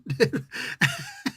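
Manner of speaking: short high-pitched giggle; amused high laugh. Real, not acted. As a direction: burst into a quick high laugh. Laughs at the comic's exchange.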